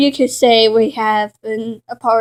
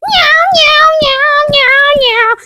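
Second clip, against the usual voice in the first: high loud sing-song meowing; kitty meows, bright and up high; party cat meows; 5 dB louder, slower than usual. Acted. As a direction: meow like a giddy kitten.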